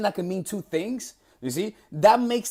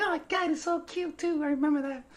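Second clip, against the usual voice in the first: high, put-on girly voice, much higher; imitating the girl; 9 semitones higher, 7 dB quieter than usual. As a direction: gush it like a smitten girl mocking herself. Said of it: "She's like".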